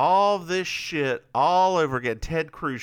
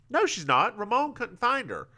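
high-pitched contradicting character; protesting character; 8 semitones higher than usual. Acted. Recapper voices the reply about Ramon, high protesting voice. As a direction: pitch it right up to argue back. Voicing two characters arguing.

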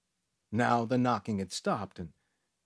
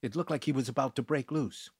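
ordinary delivery

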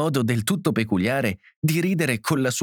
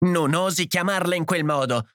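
higher-pitched, offended protest; indignant; 7 semitones higher than usual. A narrator's reading. Edith defending her daughter, indignant. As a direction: bristle in defence of your child. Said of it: "con il viso contratto per l'indignazione".

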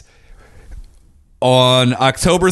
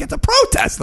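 much higher mock voice; mock teacher falsetto. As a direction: squeak it as her indignant excuse. Mocking the teacher's excuse.

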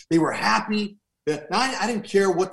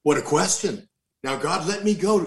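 ordinary delivery

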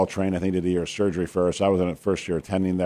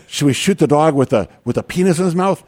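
loud, high mock-urgent question; 8 semitones higher, 7 dB louder than usual. Paramedic pushes doctor for orders, loud mock urgency. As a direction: play a paramedic pressing for orders.

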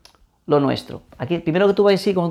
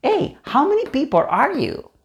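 "how many people are you" run together quickly, higher pitched; quick, lively waiter's question; 7 semitones higher than usual. Shown as a demonstration.

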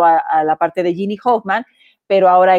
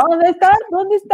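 playful calling out; 9 semitones higher than usual. Acted. Playfully calling for missing guest.

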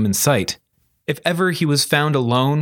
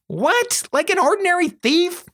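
put-on very high girl's voice, disbelieving; Charlie, let down. Girl learning her friend used no magic, high disbelieving squeak. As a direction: squeak it out as a disappointed question.

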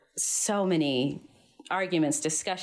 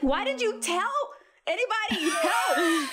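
panicked squeal; 12 semitones higher than usual. Retold or imitated. Panicked retelling of dad's reply.

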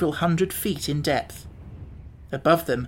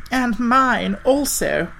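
very slow, higher gloomy voice; Wogglebug voice; 8 semitones higher, 4 dB louder, much slower than usual. A narrator's reading. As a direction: mourn it slowly as Wogglebug. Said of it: "said the Wogglebug".

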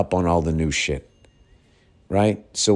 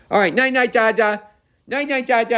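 put-on very high little-kid singsong; toddler goodnight; 4 dB louder than usual. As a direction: imitate a toddler saying goodnight. Toddler's final goodnight, voiced.

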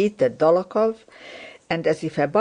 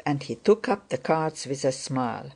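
usual speaking voice

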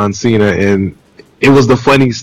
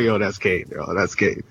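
low, hushed mock remark; quietly mimicked commenters; 5 semitones lower, 12 dB quieter than usual. Host imitates online commenters spotting Kane's move, low and hushed. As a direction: drop right down in pitch and volume, imitating onlookers.

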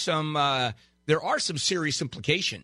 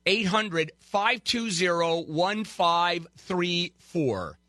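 slow number read; 4 semitones higher, much slower than usual. Shown as a demonstration. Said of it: "We're gonna pretend this is the top of the hour".

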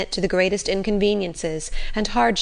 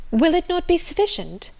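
high-pitched hopeful question; eager; 12 semitones higher than usual. A narrator's reading. Marianne asking Brandon whether a note will do, eager. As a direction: press the plea, voice lifted high. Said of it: "said Marianne eagerly".

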